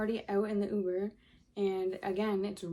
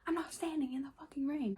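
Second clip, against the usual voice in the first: higher-pitched, indignant refusal; indignant retort; 6 semitones higher than usual. Retold or imitated. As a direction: protest it in a raised pitch. Retold refusal to wait outside.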